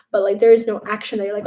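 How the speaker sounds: ordinary talk, nothing marked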